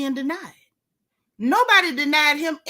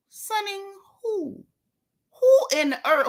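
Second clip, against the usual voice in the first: incredulous; 8 semitones higher than usual. Real, not acted. Commentator questioning who is sunning the billionaire, incredulous. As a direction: echo it slowly up high, incredulous. Echoes post in disbelief.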